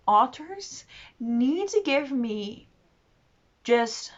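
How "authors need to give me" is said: ordinary delivery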